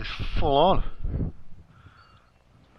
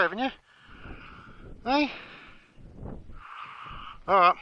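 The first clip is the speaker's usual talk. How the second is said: high cooing voice, softer, for the animals; talking to animals; 8 semitones higher, 4 dB quieter than usual. Acted. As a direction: coo at a sweet creature like a pet. Coaxing animals to eat.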